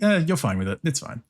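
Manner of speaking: ordinary talk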